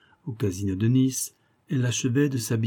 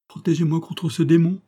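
high, raised cry for protection; frightened plea; 7 semitones higher, 5 dB louder than usual. A narrator's reading. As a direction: beg for help in alarm. Ariane begs protection from rival. (supplication alarmée, aiguë et forte)

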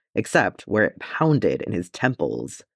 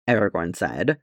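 usual speaking voice

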